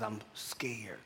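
whispered, barely voiced fear; scared whisper. Patient in hospital bed to chaplain, scared whisper. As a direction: breathe it like a frightened patient.

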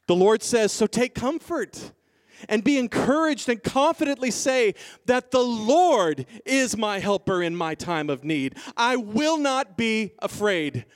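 high, forceful preaching voice; bold scripture proclamation. Acted. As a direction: declare it confidently, leaning on key words.

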